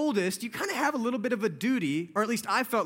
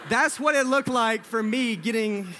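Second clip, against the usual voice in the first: louder, slower words through real laughter; laughing through it; 3 semitones higher, 6 dB louder than usual. Real, not acted. Recalling being dragged out from under the bed, laughing through it. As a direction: chuckle through the line.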